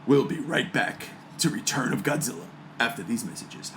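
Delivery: low, soft movie trailer announcer; deep-voiced trailer guy teasing a commercial break. Shown as a demonstration. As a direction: drop into the epic trailer voice. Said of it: "Let me get my movie voice on".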